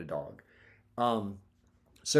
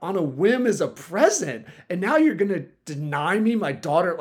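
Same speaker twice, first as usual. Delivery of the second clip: raised, louder, insulted comeback; heated retort; 7 semitones higher, 4 dB louder than usual. Retold or imitated. Owner arguing with the rescue lady for his dog, heated and raised. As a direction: snap back at the rescue lady, feeling insulted. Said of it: "I'm getting heated at this".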